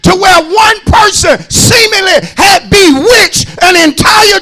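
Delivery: loud, high shouted preaching to the congregation; full-force preaching. Acted. Pastor shouting to his congregation, full-force preaching. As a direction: preach it out, pushing hard on every phrase.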